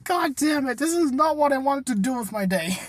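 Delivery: exasperated. Retold or imitated. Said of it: "he's like".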